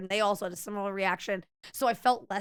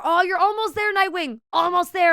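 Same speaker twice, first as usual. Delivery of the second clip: high, loud cheer; raised, bright-pitched rooting for a friend; excited encouragement; 8 semitones higher, 7 dB louder than usual. Real, not acted. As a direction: cheer them on toward the finish. Cheering on a viewer in chat.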